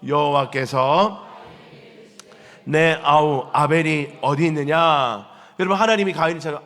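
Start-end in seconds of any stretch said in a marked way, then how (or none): 0.1-5.2 slow and loud, reading Scripture together; drawn-out unison reading aloud; slow group scripture reading. Real, not acted. Congregation reading the verse aloud together, slow and loud. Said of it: "시작".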